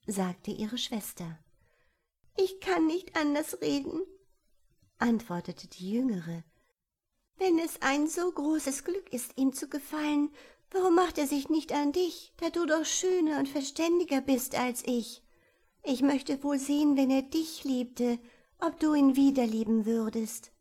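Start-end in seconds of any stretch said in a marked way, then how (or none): 2.4-4.0 put-on young girl. A narrator's reading. Kadidsche voiced by narrator. 7.4-20.5 put-on young girl. A narrator's reading. Kadidsche voiced by narrator.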